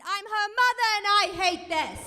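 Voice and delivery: mock mom comment. Mom's comments read out.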